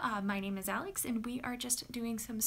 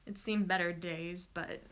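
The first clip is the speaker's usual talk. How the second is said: ordinary talk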